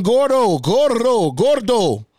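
high, drawn-out calls, falling each time; imitated yelling. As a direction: yell it out like repeated name-calling. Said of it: "they just kept yelling".

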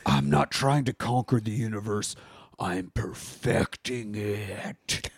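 low, slow villain, stretching the last phrase; hammed-up villain, drawing out each syllable at the end. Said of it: "talking to the High Evolutionary".

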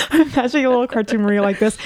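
normal talking voice

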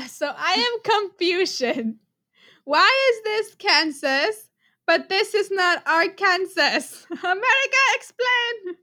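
slow, drawn-out put-on voice, rising high; mock confusion. Player recites the confused man's video line, slow mock bewilderment. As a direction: stretch the words, then climb to a high demand.

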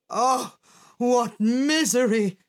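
lightly dramatic lament; mild wailing cry. A narrator's reading. Philosopher hearing of a trip to the sea, mildly crying out. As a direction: bemoan it theatrically, without going all out. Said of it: "cried the philosopher".

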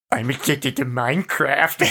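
put-on voice of a random guy on a tape; impersonated recorded confession. Random guy confessing in a booth, put-on recorded-voice impersonation. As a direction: imitate an odd recorded snippet, voice altered. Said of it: "a legit decent impersonation of what this sounded like".

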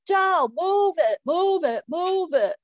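loud, urgent yell; father yelling. Retold or imitated. Father yelling at his son over a stuck record. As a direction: shout it like an impatient dad. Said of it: "my father would be yelling".

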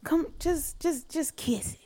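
soft, low imitation of his murmur; mock seductive murmur; hushed come-on. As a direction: coax quietly like a guy in a car.

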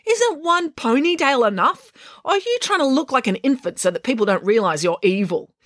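high, exasperated rant; irate rant. A narrator's reading. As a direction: rant scornfully at an annoying girl. April rants at Matilda.

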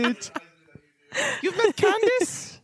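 high, bubbly elf voice; cheery high-pitched elf innkeeper welcome; jolly elf Festo. Acted. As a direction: greet them like the jolliest elf alive.

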